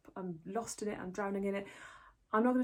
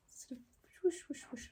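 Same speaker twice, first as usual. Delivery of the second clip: whispered whoosh; 7 semitones higher, 5 dB quieter than usual. Pretend. Mimics swishing cardigan on.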